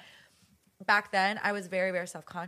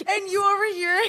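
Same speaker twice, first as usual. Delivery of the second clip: high, loud, laughing through every word; cracking up while describing it; laughing hard; 12 semitones higher, 10 dB louder than usual. Real, not acted. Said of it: "that sent me".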